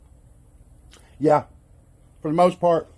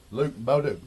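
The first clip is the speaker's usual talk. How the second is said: ordinary delivery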